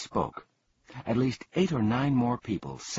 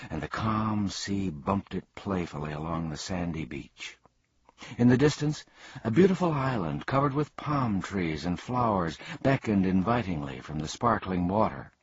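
ordinary delivery

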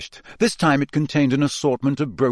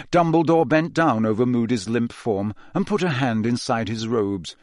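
plain reading voice

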